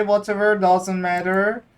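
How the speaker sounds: usual speaking voice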